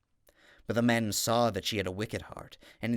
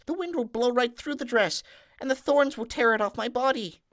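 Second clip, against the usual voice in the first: put-on much higher, pleading girl's voice with a touch of tears; pleading maiden; 14 semitones higher, 4 dB louder than usual. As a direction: beg desperately as the young maiden. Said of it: "The maiden wept bitter tears and said".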